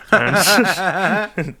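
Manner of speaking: high, loud, slow words breaking into laughter; real laughter, loud and drawn out; laughing. Real, not acted. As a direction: let the laugh take over the words. Laughing at a shared fan moment.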